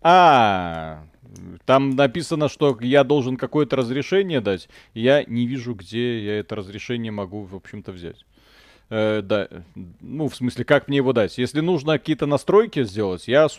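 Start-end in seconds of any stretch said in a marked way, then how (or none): none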